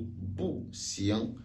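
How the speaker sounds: "bu xiang": falling "bu", then "xiang"; unchanged bu. Shown as a demonstration. Teacher shows bu reste tel, falling "bu" before "xiang". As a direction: drop "bu" sharply before "xiang". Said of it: "Le bu reste tel".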